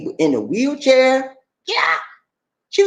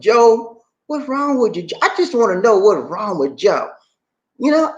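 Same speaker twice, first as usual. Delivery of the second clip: ordinary talk, nothing marked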